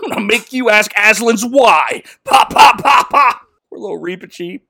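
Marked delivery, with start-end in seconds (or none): 2.2-3.4 gunshot noises. Retold or imitated. Imitating gunshots at Reepicheep.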